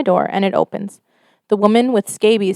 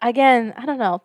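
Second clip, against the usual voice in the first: usual speaking voice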